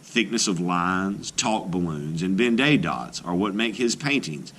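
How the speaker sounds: usual speaking voice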